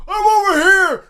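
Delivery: high, loud call from the ground. Pretend. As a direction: yell to be found, pitch held high. Dropped head calling to its body.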